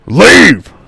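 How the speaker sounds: loud, high one-word command; furious dismissive yell; angry half-orc shout. Acted. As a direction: roar the order to get rid of them. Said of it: "And he says".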